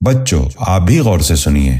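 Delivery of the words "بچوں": usual speaking voice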